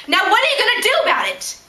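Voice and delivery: exasperated demand. Acted. Patient confronting staff over waiting room, exasperated demand. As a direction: demand an answer, pitch climbing.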